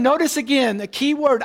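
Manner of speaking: normal talking voice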